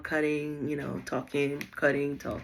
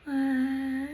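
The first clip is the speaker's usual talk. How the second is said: put-on faint baby cry; 10 semitones higher than usual. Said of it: "like, barely crying".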